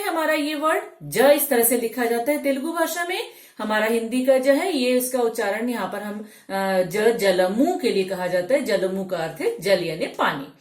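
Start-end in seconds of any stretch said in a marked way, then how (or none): none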